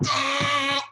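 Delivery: a short, bright "Ah" with some lift; mock dance whoop; happy little twerk yelp. Shown as a demonstration. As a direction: let out a giddy little squeal. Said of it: "you just do a little".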